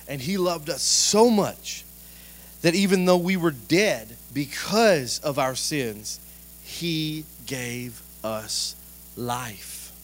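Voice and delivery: slow, measured reading aloud; scripture reading. Acted. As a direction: read the verse slowly, letting it sink in.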